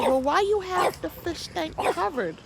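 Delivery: high, curious questioning; puzzled question. Retold or imitated. Asking the neighbor about her covered tank, high and puzzled. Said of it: "So I was like".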